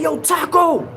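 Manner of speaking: loud, high call through the wall. Retold or imitated. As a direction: shout his name to reach him. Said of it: "yo, I was like".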